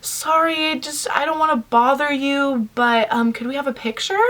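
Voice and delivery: small high voice, softening at the end; timid. Retold or imitated. Fan timidly asking a celebrity for a picture, hesitant. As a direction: act out a hesitant, apologetic request. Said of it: "and really timidly".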